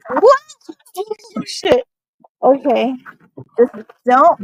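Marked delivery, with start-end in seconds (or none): 0.1-0.4 high sharp loud what; startled. Real, not acted. Startled while slipping. 1.0-1.8 high alarmed gasp of words; nearly falling, panicked; startled. Real, not acted. Slipping again on the ice.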